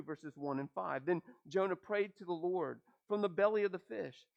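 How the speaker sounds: ordinary delivery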